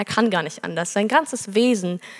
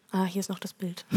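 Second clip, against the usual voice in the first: ordinary talk, nothing marked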